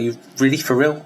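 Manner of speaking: can't believe what the doctor just said; incredulous. Retold or imitated. Disbelief at doctor offering pills.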